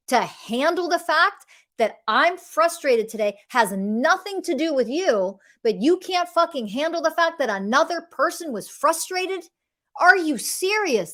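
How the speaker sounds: indignant rant climbing in pitch; exasperated disbelief. Acted. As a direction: build to an incredulous question. Incredulous rant at intolerant partner.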